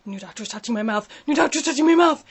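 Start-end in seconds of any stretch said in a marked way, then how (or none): 1.3-2.2 higher, louder panicky repeat; freaked out. Retold or imitated. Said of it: "I'm like".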